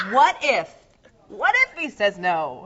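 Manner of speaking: loud, hammed-up what-if, slowing down; dramatic imagined thought. Actress voicing her jilted character's hope, loud mock drama. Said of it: "Because I thought".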